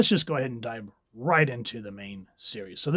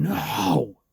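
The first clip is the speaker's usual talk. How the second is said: higher, louder anguished cry of no; scream; 5 semitones higher, 5 dB louder than usual. Acted. Wanda refusing Xavier, screaming in anguish. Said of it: "you just see her scream".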